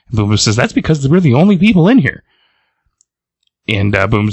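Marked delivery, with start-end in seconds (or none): 0.6-2.2 high sing-song comeback; mocking retort. Boom Boom mocking Dazzler's favorite bar, teasing. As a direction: needle her with a pitched-up retort.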